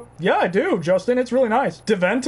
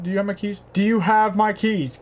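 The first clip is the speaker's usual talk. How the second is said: ordinary delivery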